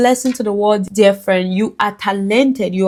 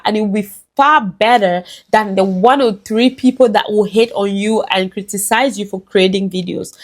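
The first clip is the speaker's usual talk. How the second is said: ordinary talk, nothing marked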